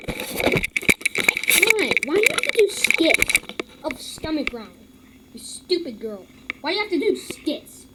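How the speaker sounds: exasperated rant. Real, not acted. Kid ranting at a girl's stomach-growl channel, exasperated. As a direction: scold her in disbelief.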